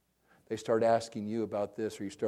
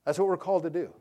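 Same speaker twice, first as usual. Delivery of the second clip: loud, raised-pitch declaration; impassioned firefighter; 6 semitones higher, 8 dB louder than usual. Retold or imitated. Nephew retelling his calling, loud and fervent. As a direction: proclaim it like a firefighter's creed. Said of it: "He goes".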